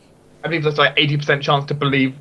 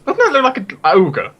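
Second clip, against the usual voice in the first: curious onlooker; 9 semitones higher than usual. Pretend. Voicing people looking up.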